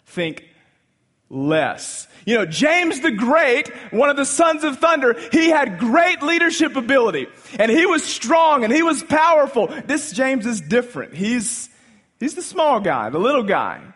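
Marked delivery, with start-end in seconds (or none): 2.2-13.8 high, loud preaching build; full-force preaching. Acted. Pastor rousing the congregation about James, in full-force preaching. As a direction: preach it out to the back pew.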